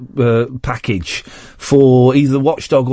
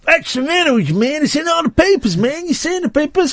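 high-pitched exasperated character voice; put-on protest; 12 semitones higher than usual. Host mock-scolding his doubting co-host, high put-on voice. Mock outburst at co-host's question.

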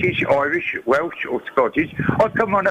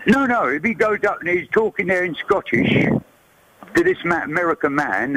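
ordinary talk

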